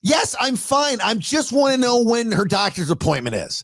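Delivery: high-pitched, defensive mock slur; slurring mother. Mother insisting to her son she's fine, slurring. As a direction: imitate a woozy mom insisting she's fine. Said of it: "You're slurring your words".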